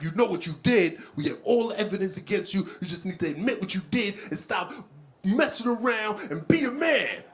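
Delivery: interrogating prosecutor. Acted. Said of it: "He was interrogating the dude".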